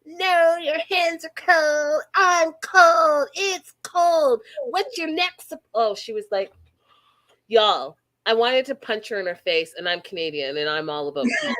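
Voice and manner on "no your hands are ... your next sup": high, mocking whine; whiny bride. Mocking the bride refusing his hug, high whiny voice. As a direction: whine like a spoiled bride pushing him away. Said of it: "She's like".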